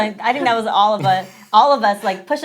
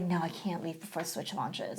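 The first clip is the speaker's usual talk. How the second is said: very soft, lower aside; retold private resolve; 3 semitones lower, 16 dB quieter than usual. Employee turning down a job offer, quiet resolve. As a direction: say it low, as if to yourself. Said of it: "And I was like".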